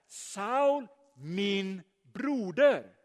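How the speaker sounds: spaced out grandly, each word given room; brotherly address. Retold or imitated. Ananias greets his former persecutor as a brother, slow and emphatic. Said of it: "han säger så här".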